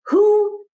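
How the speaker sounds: "who" lifted high and drawn out; "who" sung up high and held before the rest; stress on who. Shown as a demonstration. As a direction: lean hard on "who", then pause. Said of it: "emphasizing the key question".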